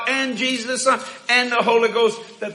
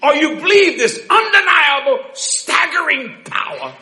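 raised, punchy preacher delivery; full-force preaching; 8 semitones higher, 5 dB louder than usual. Acted. Preacher pressing the congregation on the church's power, emphatic preaching. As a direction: drive it home, stretching the last words.

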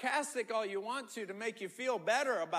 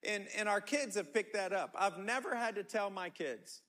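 ordinary delivery

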